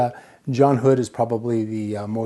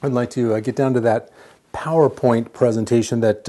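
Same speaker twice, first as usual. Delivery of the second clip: usual speaking voice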